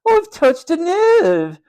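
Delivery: slow, very high, loud taunt; mocking matron. A narrator's reading. Matron needling George, drawn-out mocking. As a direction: draw it out to tease him. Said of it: "she added mockingly".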